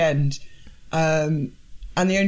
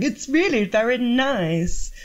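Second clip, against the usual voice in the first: much higher, slow, syrupy mock sweetness; mock sugary sweetness; 4 semitones higher, slower than usual. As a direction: coo it like a saccharine sales pitch, slowly. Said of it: "everything sort of being served going".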